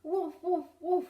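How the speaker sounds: loud playful barking; mock woofs for the kids; dog woof. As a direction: bark like a happy dog. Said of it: "Can you make that sound?".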